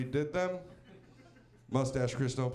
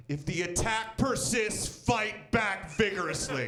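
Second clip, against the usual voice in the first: high, slowed capital-letter command; a touch of a shouted sign; all caps; 6 semitones higher, slower than usual. Retold or imitated. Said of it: "in all caps".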